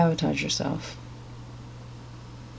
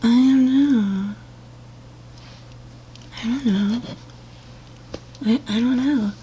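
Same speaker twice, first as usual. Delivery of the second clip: higher, slowed mocking whimper of not knowing; mock whiny confusion; 6 semitones higher than usual. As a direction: whine it like a clueless patient. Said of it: "and they're just like".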